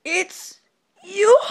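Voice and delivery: high, loud disbelief; shocked. Acted. Cashier shocked to see the customer back. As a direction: gasp it in disbelief.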